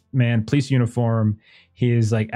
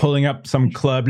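ordinary delivery

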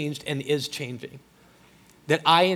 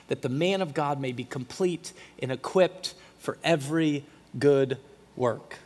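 usual speaking voice

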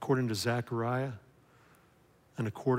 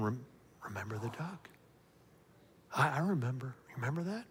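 soft, much quieter taunting whisper; accusing whisper; 9 dB quieter than usual. Retold or imitated. The enemy whispering past sins to a listener, soft and sly. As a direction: sneer it softly like a tempter.

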